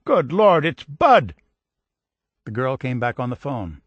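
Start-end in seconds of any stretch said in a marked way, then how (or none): 0.1-1.3 high, loud astonished cry; overjoyed shout. A narrator's reading. Family member crying out at the lost soldier's return. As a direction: burst out in joyful disbelief. Family hears Bud is alive.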